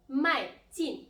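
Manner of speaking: 迈进 loud and high, each syllable falling sharply; emphatic falling tones on 迈进; fourth-tone demo. Shown as a demonstration. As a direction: punch both syllables of 迈进 downward. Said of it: "now the fourth tone".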